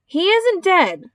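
high, drawn-out protest; hollering. A narrator's reading. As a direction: holler it in indignant denial. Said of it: "hollered Zuckerman".

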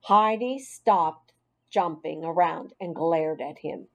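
usual speaking voice

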